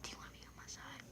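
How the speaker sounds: quick secret whisper; conspiratorial whisper. Retold or imitated. Girl secretly inviting her to join the gangsters, in a fast whisper.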